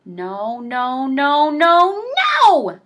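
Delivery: rising into a shouted no; building shout. Acted. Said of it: "shouted the group".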